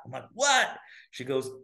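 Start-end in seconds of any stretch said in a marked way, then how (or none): 0.3-0.7 a high, louder startled what; shocked what. Retold or imitated. Hearing his sister has a name for it, shocked high what. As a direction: jump up in pitch, caught off guard.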